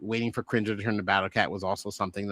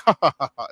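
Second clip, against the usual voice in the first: mock hearty ha-ha-ha, a bit louder; hammed-up cartoon laughter; cartoon group laugh; 2 semitones higher, 5 dB louder, faster than usual. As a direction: imitate the cartoon heroes' head-back laugh. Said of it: "was how they all laugh".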